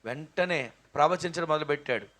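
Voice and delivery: normal talking voice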